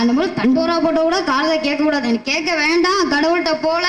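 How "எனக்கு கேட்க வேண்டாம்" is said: ordinary talk, nothing marked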